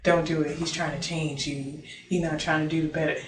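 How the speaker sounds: quiet coaxing devil on the shoulder, just lightly voiced; devil whispering temptation. A hint of the devil softly urging her to quit. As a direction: hush it like a tempting little devil. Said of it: "the devil trying to confuse me and trying to convince me".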